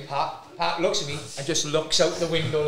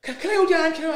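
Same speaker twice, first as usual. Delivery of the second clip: higher, pleading imitation of a scared passenger; mock nervous pleading; 11 semitones higher, faster than usual. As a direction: ask to hold the hand, half-laughing. Nervous woman begs on plane.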